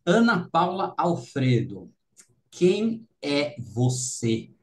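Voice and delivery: slow, spaced-out delivery with weighty pauses; dramatic question. Real, not acted. Host puts signature first question to guest, slow and dramatic. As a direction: draw out each word of a ceremonial question.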